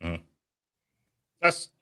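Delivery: ordinary talk, nothing marked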